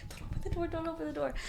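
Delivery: high hushed whisper, pleading; urgent whisper. Retold or imitated. Sister begging her brother not to open the door, urgent whisper. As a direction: whisper it fast so he won't hear.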